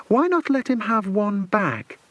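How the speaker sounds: high, playful taunt; mocking. A narrator's reading. Mother taunting the villain, mocking and high. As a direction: toss it out with teasing spite. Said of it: "she mocked".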